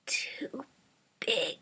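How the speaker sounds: soft whisper. A narrator's reading. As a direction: whisper the words like a frail old woman. Grandma's broken whisper.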